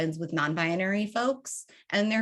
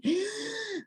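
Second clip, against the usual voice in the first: a high, soft gasp for breath; desperate gasp mid-sentence; sharp gasp for air; 5 dB quieter than usual. Shown as a demonstration. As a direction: gulp in a shallow breath. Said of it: "not good".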